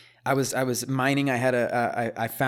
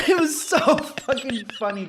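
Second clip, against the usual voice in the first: high, laughing delight; 12 semitones higher, 3 dB louder than usual. Real, not acted. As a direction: say it while cracking up. Cracking up at own story.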